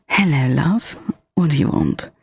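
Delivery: lightly put-on British accent. Demonstrating "love" for someone who asked, lightly British accent. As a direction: greet them sweetly with a touch of British. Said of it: "Try love for me".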